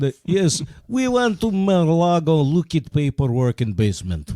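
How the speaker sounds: thick mock Russian; Russian accent. Russian telling of Mar-a-Lago paperwork, Russian accent. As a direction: play a cagey Russian in broken English. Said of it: "We could talk Russian".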